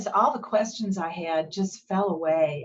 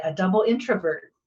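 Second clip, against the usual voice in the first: ordinary delivery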